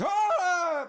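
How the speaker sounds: loud, high, long yell; sudden mock scream. Retelling the monster jumping out, long mock scream. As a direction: burst out with a drawn-out scream.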